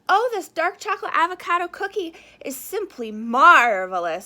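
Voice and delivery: the Duke raving about a cookie; delighted praise. Acted. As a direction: rave about the taste.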